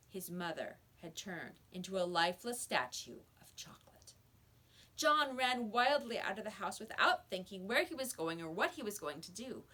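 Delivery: ordinary delivery, nothing marked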